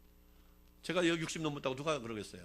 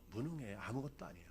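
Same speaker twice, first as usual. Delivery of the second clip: normal talking voice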